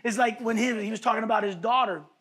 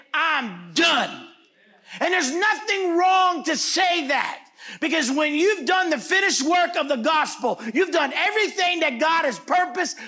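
full-force preaching; 5 semitones higher, 3 dB louder than usual. Real, not acted. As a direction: preach it loud and high, landing hard on key words.

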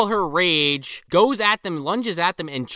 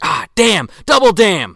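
building louder and higher mock curse; mock exasperation; 5 semitones higher, 7 dB louder than usual. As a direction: ham up the frustration, bigger on the second damn. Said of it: "And she's like".

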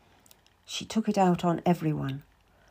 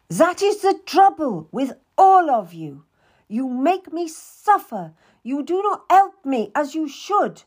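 high, loud aggrieved complaint; bitter rage; 7 semitones higher, 5 dB louder than usual. Acted. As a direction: accuse everyone of making her suffer. Queen's bitter outburst continues.

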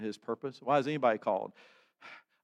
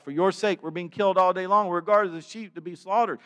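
loud, high cry of protest; raised, urgent lament; 7 semitones higher, 9 dB louder than usual. Acted. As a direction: cry it out to God. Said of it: "they cried out".

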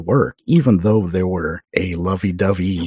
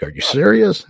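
high, louder disbelief; retold astonished question; incredulous; 12 semitones higher, 5 dB louder than usual. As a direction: react amazed to an unexpected offer. Retold reaction to job offer.

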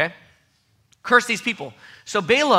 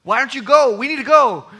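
loud, much higher exasperated retort; arguing; 11 semitones higher, 14 dB louder than usual. Retold or imitated. Balaam argues with his donkey, in a loud, high exasperated retort. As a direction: snap back at the stubborn donkey. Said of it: "He just keeps arguing with the donkey".

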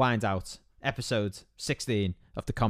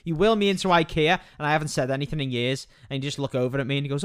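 normal talking voice

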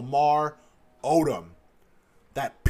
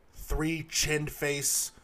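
ordinary talk, nothing marked

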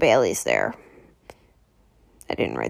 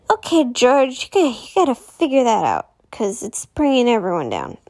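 exasperated scolding; 6 semitones higher, 4 dB louder than usual. Real, not acted. Vlogger scolds a TV character.

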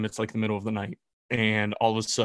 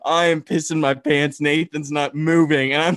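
laughing while telling; 5 semitones higher, 8 dB louder than usual. Real, not acted. To his co-host about the bear prank, loud laughing retelling. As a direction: laugh through the scary bit.

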